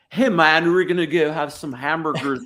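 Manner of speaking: overeager mock-European American accent, starting loud; European faking American. European guy inviting pals for hamburgers, faked American accent. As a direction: try too hard to sound American, European accent showing. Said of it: "European guy trying to be real American".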